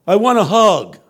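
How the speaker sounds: high, loud, eager answer; homeless man's plea. Retold or imitated. The homeless man asks the preacher for a hug, high and loud. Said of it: "He said".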